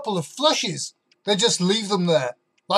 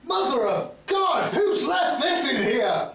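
horrified; 5 semitones higher than usual. Acted. Horrified at the toilet.

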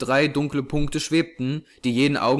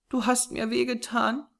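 put-on high little-girl voice, complaining; reproachful child's voice; 10 semitones higher, 4 dB quieter than usual. A narrator's reading. Hurt small girl to the man who dropped her, reproachful. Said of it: "klagte eine Kinderstimme in vorwurfsvollem Ton".

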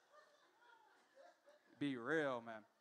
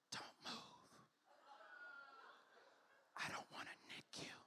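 barber whispering close to your ear; soft whisper; 21 dB quieter than usual. Retold or imitated. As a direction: breathe it, barely a sound. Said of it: "his voice get real soft".

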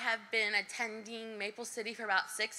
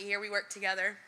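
ordinary talk, nothing marked

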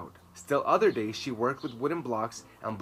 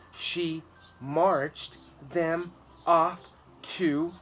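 slow halting reading; 5 semitones higher, 4 dB louder, much slower than usual. A narrator's reading. Girl reading aloud slowly.

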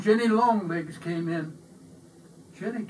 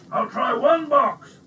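louder and higher, slow and emphatic; excited; 7 semitones higher, 9 dB louder than usual. A narrator's reading. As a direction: declare it with gusto. Baker decides excitedly.

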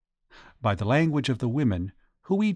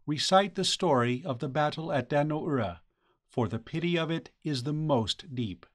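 soft, courtly matron's answer; woman's voice; 3 semitones higher, 6 dB quieter than usual. A narrator's reading. Woman answering Huichi's question, softly formal. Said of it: "The woman's voice made answer".

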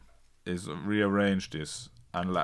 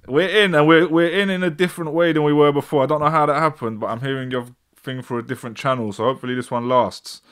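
excited; 6 semitones higher, 9 dB louder than usual. Real, not acted. Excited after reconnecting.

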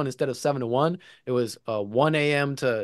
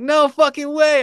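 high, loud, stretched-out elation; excited disbelief; 7 dB louder than usual. Retold or imitated. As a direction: reenact thrilled amazement, drawing the words out high.

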